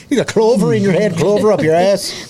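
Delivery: put-on Irish accent. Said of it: "how to do an Irish accent".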